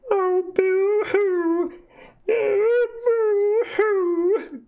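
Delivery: very high, slow hammed-up boo-hoo wail; high comic crying gnome; gnome sobbing. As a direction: wail like a tiny weeping gnome. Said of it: "sobbing into his hands".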